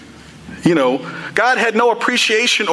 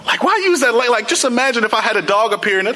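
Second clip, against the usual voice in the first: normal talking voice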